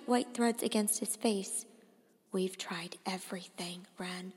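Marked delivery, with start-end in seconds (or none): 2.3-4.3 soft, whispered resignation; defeated father, barely audible; hushed. A narrator's reading. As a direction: say it almost under your breath. Father's weary final word.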